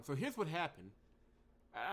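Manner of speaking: ordinary talk, nothing marked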